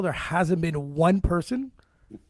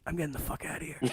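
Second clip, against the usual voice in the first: whisper; 3 semitones lower, 12 dB quieter, faster than usual. Retold or imitated. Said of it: "I whisper to Lucas".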